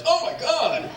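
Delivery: sudden high yelp of alarm; startled shriek. Retold or imitated. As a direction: jump out of your skin, high and sharp. Startled by dog in purse.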